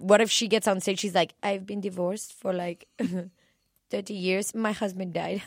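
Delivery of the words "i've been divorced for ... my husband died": put-on soft, accented singer doing a bit. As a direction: deliver a mock stand-up bit in a singer's accent. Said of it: "has this sweet little voice".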